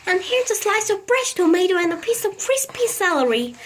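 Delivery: put-on doting old lady. A narrator's reading. Mrs Silver feeding her tortoise.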